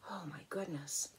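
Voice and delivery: whispered amazement; awed whisper. Real, not acted. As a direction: breathe it out in awe. Amazed hush.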